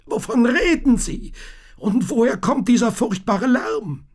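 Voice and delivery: put-on a scared boy asking over the noise; alarmed young Thomas; startled boy. A narrator's reading. Thomas questions the tinker.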